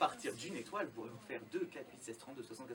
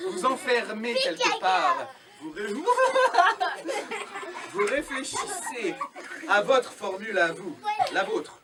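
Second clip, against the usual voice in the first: put-on kids party magician; 7 semitones higher, 15 dB louder, slower than usual. Magician solemn instruction to child.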